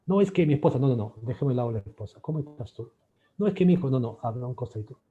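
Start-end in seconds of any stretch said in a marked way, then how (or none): 0.1-1.1 higher, louder excuse; mock client protest. Client dodging the therapist's question, louder and higher. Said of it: "acted client deflecting". 3.4-4.2 higher, louder excuse; mock client protest. Client dodging the therapist's question, louder and higher. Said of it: "acted client deflecting".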